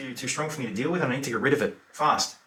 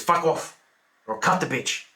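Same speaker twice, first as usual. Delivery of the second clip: louder, higher voice warning someone off; acted angry threat; 6 semitones higher, 5 dB louder than usual. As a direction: throw out the threat like a cornered attacker. Threat by knife man, retold.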